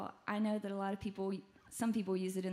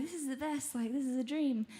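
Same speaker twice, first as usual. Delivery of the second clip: mild re-enacted thrill, higher at the start; retold excitement; 4 semitones higher than usual. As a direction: gush lightly, as if recalling delight. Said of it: "I was really excited about this".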